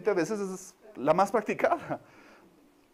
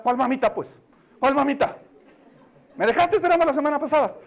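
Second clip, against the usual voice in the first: higher and louder, reproachful; scolding mother; 7 semitones higher, 5 dB louder than usual. Retold or imitated. Old mother scolding her grown son for not visiting, louder. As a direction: scold like an offended mom.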